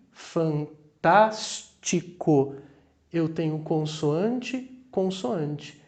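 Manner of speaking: fantástico slowed down so the s and t sound separately; slow syllable demo. Shown as a demonstration. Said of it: "Mas aqui". (fantástico devagar, separando o s e o t)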